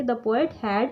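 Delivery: word by word with long pauses between; teacher dictating while students copy; slow dictation with pauses. Shown as a demonstration. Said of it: "हम क्या लिखेंगे".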